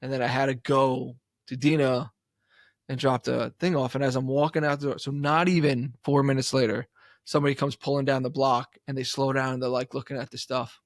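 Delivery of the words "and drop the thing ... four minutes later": ordinary talk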